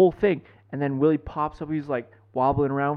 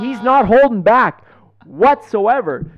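excited; 9 semitones higher, 10 dB louder, slower than usual. Real, not acted. Hyped retelling of the dad.

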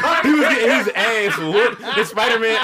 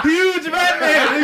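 drawn-out call; 9 semitones higher, 3 dB louder, much slower than usual. Retold or imitated. Calling huge Batman slowly.

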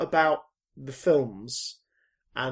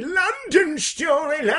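high, drawn-out comic cry; mock exasperated wail; 11 semitones higher, 4 dB louder, slower than usual. Host bemoaning another London storyline, mock exasperated wail. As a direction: wail it out, stretching every syllable.

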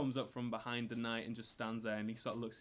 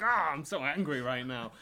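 mock angry; 3 semitones higher, 10 dB louder than usual.

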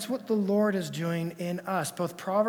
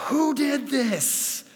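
high, slow, loud weary demand; fed-up dad; 10 semitones higher, 6 dB louder, much slower than usual. Retold or imitated. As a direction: at the end of a long day. Tired dad finds spilled flossers.

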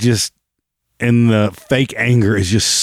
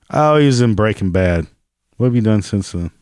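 usual speaking voice

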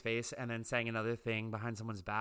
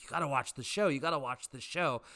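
slightly raised voice of insistent fans; imitated nagging; 3 semitones higher than usual. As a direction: mimic pestering fans, lightly put on.

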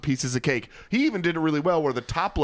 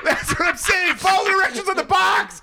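high-pitched, ear-splitting yell; exasperated shouting; 4 dB louder than usual. Real, not acted. Baker shouting over co-hosts about boxed cake mix, high exasperated yell. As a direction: yell your point until the mic peaks. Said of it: "We apologize to our listeners' ears".

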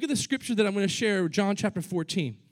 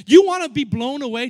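loud challenge; 2 semitones higher, 9 dB louder than usual. Acted. Preacher's loud question.

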